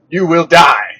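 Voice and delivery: sudden loud "you will die"; mock shout. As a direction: blurt it out loud for effect. Dramatic punchline about traffic.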